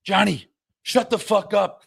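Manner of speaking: high, slow snap back; annoyed ref. Retold or imitated. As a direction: ref snapping at the coach. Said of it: "He goes".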